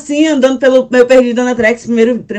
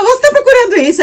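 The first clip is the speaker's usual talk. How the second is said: helpful stranger; 9 semitones higher than usual. Retold or imitated. Voicing boy who found the wallet.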